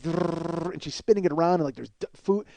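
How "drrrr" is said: rolled wood-chipper buzz; imitated gnawing noise. Imitating a comedian's squirrel bit, gnawing noise. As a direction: rattle it out like fast gnawing. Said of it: "So she's like".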